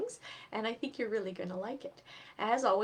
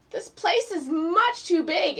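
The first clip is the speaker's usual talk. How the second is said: moaning; 8 semitones higher, 9 dB louder, slower than usual. Acted. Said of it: "Eddie moaned".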